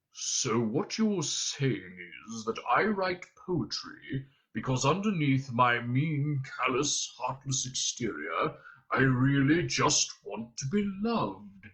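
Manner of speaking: slow, catlike alien rasp; scratchy Vogon voice. A narrator's reading. Vogon sums up the critique, slow and scratchy. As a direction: drag it out like a cat snagging nylon. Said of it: "the quality of a cat snagging brush nylon".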